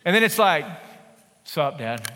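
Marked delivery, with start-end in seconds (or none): 1.4-2.1 put-on low, soft, offhand teen mumble; a teen barely bothering to greet dad; bored teenager. Fourteen-year-old's bored greeting.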